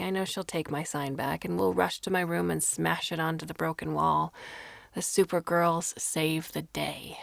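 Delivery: ordinary delivery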